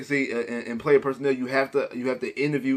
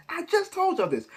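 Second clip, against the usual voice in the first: jumps way up in pitch, exasperated; high exasperated; 12 semitones higher, 4 dB quieter than usual. Acted. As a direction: throw it up high like you can't believe it. Repeating his point, now high.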